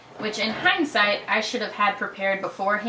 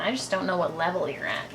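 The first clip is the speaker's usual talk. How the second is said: ordinary talk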